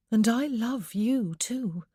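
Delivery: wife sincerely returning love; tender declaration. A narrator's reading. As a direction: tell him you love him, slowly.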